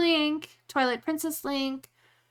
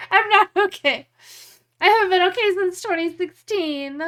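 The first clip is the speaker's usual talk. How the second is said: higher, louder hammed-up wail; mock despair; 5 semitones higher, 5 dB louder than usual. Streamer overplays despair to chat, hammed-up wail. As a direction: overplay not being okay. Dramatic joking complaint.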